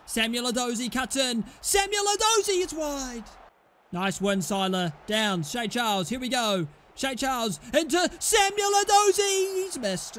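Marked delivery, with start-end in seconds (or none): 1.6-3.2 excited shout. Real, not acted. Gamer calling his winger's chance, high shout. 6.9-10.2 name yelled high and loud; excited shout. Real, not acted. Gamer calling his winger's chance, high shout.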